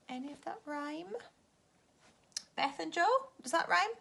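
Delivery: high, loud, teasing question; teacher quizzing pupils about rhymes; playful quiz. Acted. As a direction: ask it with mock suspense.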